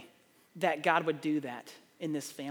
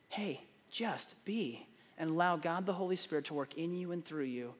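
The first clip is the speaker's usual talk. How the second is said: ordinary talk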